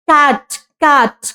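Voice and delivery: कैट said slowly twice, leaning on the k; slow model word. Shown as a demonstration. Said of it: "बोलते हो ना कैट".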